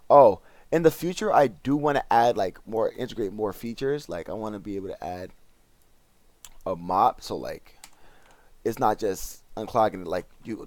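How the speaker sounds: ordinary talk, nothing marked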